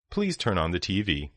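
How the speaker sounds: normal talking voice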